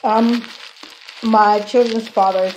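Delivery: speaking with food in mouth; words muffled by chewing; talking while chewing. Real, not acted. Seen 1: closed puffed lips early; later mouth looks clear while gesturing.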